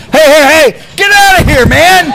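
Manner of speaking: loud, high, furious shouting; angry yell. Real, not acted. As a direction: yell at him to get out. Commentator yells at ring intruder.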